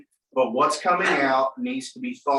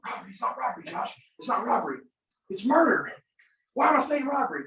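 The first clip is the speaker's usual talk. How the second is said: higher, mock-flustered self-talk; panicking inner voice; 8 semitones higher, faster than usual. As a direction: argue with yourself in a squeaky aside, building higher. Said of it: "My brain is going".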